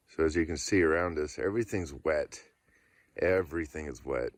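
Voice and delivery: ordinary talk, nothing marked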